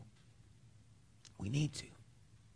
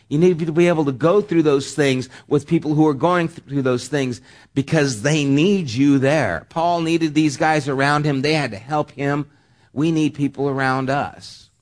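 loud, higher preaching voice; 5 semitones higher, 8 dB louder than usual. Acted. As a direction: drive the point home to the pews. Preaches the point at full force.

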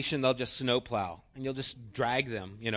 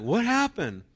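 higher, loud bewildered question; mock dazed surprise; puzzled dog; 10 semitones higher, 10 dB louder than usual. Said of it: "looking like".

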